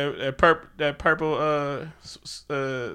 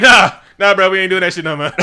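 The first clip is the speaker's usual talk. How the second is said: loud, laughing Oakland dismissal; Oakland accent; 4 semitones higher, 11 dB louder than usual. Acted. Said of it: "that was Oakland".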